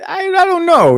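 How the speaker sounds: laughing high and loud; giggling through the words. Real, not acted. Laughing after mom comment.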